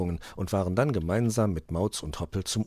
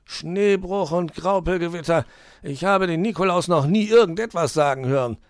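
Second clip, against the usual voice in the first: high, loud defiant outburst, slowing at the end; stubborn fox; 11 semitones higher, 9 dB louder than usual. A narrator's reading. Herr Fuchs refuses to wear the beard, defiant. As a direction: put his foot down. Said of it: "beschloss der Fuchs".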